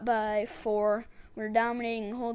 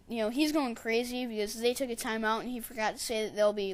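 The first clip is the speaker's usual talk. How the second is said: normal talking voice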